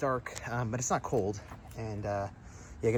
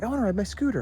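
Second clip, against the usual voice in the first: higher-pitched mock child voice; imitated eager kid; 8 semitones higher, faster than usual. Kid asking parent to ride a scooter, imitated eager child.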